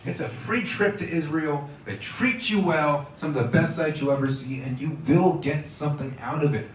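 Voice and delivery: normal talking voice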